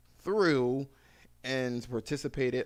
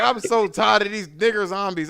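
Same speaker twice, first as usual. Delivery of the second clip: friend's complaint; 8 semitones higher, 10 dB louder than usual. Retold or imitated. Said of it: "He was like".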